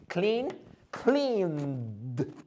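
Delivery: clean then cleaned with a soft held d; soft d contrast. Shown as a demonstration. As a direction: add only a soft d for cleaned. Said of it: "controlled breath".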